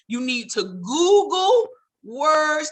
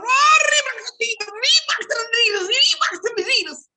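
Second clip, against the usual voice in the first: very high shouted Reebok to the Adidas; young folks hollering; 11 semitones higher, 4 dB louder than usual. Retold or imitated. Young folks hollering in church, high shout. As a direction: holler it like praise. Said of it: "they was hollering".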